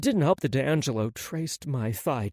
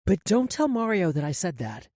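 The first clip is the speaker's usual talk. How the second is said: light, higher woman's voice; Maggie's voice; 5 semitones higher than usual. A narrator's reading. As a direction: lift the voice into Maggie.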